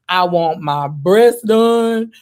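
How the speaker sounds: ordinary delivery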